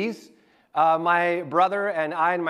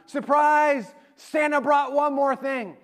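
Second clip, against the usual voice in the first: higher-pitched announcement of a surprise; excited dad's surprise; 7 semitones higher, slower than usual. Retold or imitated. Dad coming back out with the big gift, excited. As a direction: imitate a dad springing a happy surprise.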